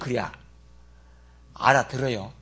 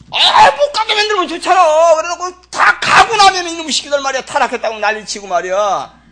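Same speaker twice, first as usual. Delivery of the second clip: raised, exasperated preaching; louder, exasperated, half-joking protest; loud complaint; 8 semitones higher, 9 dB louder than usual. Real, not acted. Preacher to congregation, loud exasperated protest.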